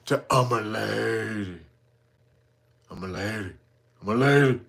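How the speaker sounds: low, hushed mocking imitation; deep, quiet put-on voice; mock lady voice. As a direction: drop it deep and soft, mock-ladylike. Mocks a person claiming ladyhood.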